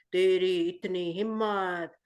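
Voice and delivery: low, slow booming monster; big monster voice. Shown as a demonstration. The big Bogart threatens Harry in a low slow monster voice. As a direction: growl it out like a huge monster, slowly. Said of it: "पहले Bogard की आवाज कैसे निकल रही थी".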